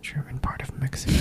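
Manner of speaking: whisper. Real, not acted. Whispered musing echo.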